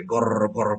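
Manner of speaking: slow, drawn-out mock growl; imitated dog growl. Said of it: "কুকুরটা growl করছে".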